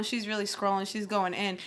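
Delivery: normal talking voice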